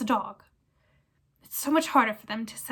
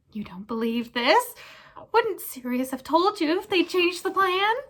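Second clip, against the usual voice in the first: high, loud whining question; pleading squeak; 7 semitones higher, 3 dB louder than usual. Acted. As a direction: wheedle desperately. Pettigrew implores Lupin.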